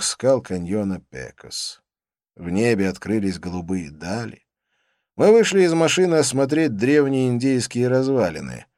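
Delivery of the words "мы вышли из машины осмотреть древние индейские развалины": ordinary delivery, nothing marked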